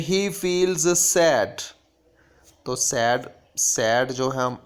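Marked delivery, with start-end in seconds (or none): 0.0-1.6 slow, deliberate reading of the example sentence. Shown as a demonstration. Teacher reading an example sentence to the class, slow and clear. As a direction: read it out slowly like a teacher at the board.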